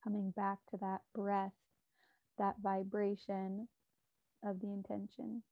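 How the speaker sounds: calm, measured meditation guide with long pauses; unhurried, soothing meditation voice; guided meditation. Real, not acted. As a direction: guide listeners gently inward, leaving space between phrases.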